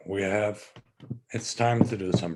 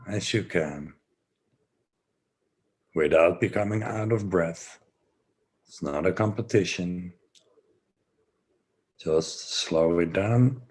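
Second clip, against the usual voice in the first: guided breathing; 5 semitones lower than usual. Acted. Guides slow breathing session.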